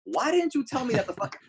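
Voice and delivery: high-pitched exasperated protest; mock indignation. Retells indignant reply to prankish accuser.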